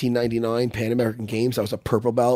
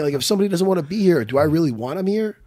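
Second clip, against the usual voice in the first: ordinary delivery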